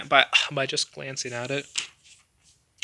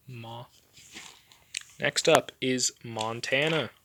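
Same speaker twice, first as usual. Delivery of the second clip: ordinary talk, nothing marked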